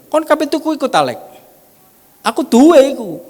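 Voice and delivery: high, loud retold exchange; acted eager exclamation. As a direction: pitch it way up, punchy and loud. Acted dialogue about buying the laser.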